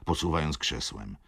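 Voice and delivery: usual speaking voice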